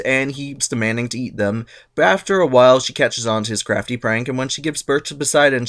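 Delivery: usual speaking voice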